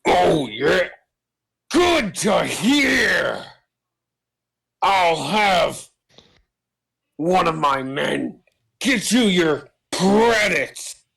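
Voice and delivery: slow, drawling shout pitched well above your own; put-on boss voice. Crime boss promising the crew their credits, put-on high slow voice. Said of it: "I gotta get his voice right".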